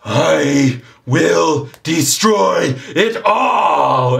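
slow, high, drawn-out villain shout; roaring demonic snowman. Acted. Demonic snowman turns on the whole party, roaring threat. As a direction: stretch every word into a monstrous threat. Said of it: "turns to you all and says".

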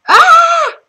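high, loud startled shriek. Retold or imitated. Telling her viewers about the spider, startled shriek. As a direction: shriek like the spider just jumped out. Retelling being scared by spider.

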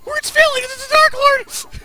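very high, squealing cartoon hysteria; hysterical panic. Youngest fairy reporting sisters taken, squealing panic. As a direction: stammer out the news in a hammed-up frenzy. Voicing Ren panicking, words failing.

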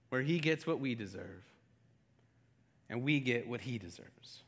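low, soft, reverent preaching; solemn quiet preaching; hushed sermon. Acted. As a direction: draw them in quietly, letting each phrase land.

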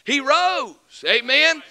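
loud, high proclaiming; a preacher's triumphant cry; full-force preaching. Acted. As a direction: shout the resurrection to the church.

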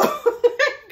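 loud high burst of laughter; real giggling laugh. Real, not acted. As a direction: crack up at yourself. Laughs at herself.

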